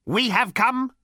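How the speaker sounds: cold raspy machine voice; rasping robot. A narrator's reading. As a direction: rasp it out coldly. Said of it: "rasped the robot".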